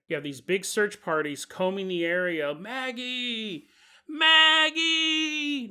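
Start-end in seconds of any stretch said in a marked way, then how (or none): 2.5-5.7 calling a name across the woods. Acted. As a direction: shout the name into the jungle. Search party calling out.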